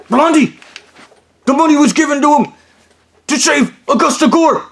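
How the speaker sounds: high yelled call, rushed; mock shout. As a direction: holler across the room in a high voice. Kevin yelling for Blondie.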